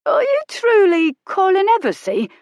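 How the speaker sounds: high, loud, wide-eyed footman voice; awestruck footman. Acted. Footman meeting famous Colin, high and awestruck. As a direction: ask it in starstruck awe.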